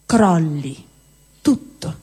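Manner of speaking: ordinary talk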